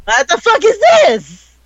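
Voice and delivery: high-pitched, drawn-out exasperation; loud, slow, squeaky-high bafflement; incredulous outburst. Real, not acted. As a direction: cry out in disbelief at the nonsense. Teller's exasperation at the plot.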